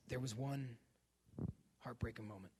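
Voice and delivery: low, hushed dramatic buildup; hushed suspense. Acted. Comic telling the audience about Oprah, hushed and ominous. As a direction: drop to a near whisper for suspense. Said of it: "dramatic hushed buildup".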